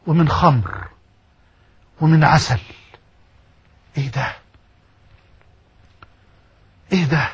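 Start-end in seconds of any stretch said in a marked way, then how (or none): 4.0-7.3 much lower and softer, awestruck; hushed awe. Real, not acted. Preacher imagining paradise, hushed wonder. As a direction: breathe it out in wonder. Awed whisper-like question.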